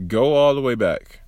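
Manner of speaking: normal talking voice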